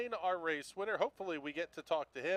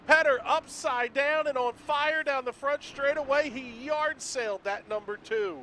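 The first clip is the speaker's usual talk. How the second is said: loud, high crash call; motorsport commentator; 9 semitones higher, 8 dB louder than usual. Acted. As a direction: call the wreck with urgency. Calling the crash live.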